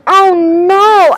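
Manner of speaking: loud, high, stretched-out cry; horrified scream. A narrator's reading. Girl reacting to a crash in the house, screaming. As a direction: read out a scream of dismay. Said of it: "I scream".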